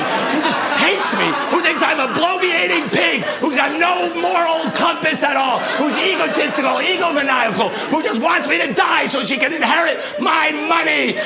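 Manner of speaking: high-pitched, building comic tirade; mounting impassioned rant. Acted. Man confesses his fantasy to the president, mounting high rant.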